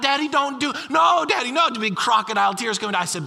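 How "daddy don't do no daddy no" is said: higher, louder pleading no Daddy; child begging, voice climbing; imitating panicked child. Dad retelling daughter's panic, higher pleading child. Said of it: "she starts freaking out".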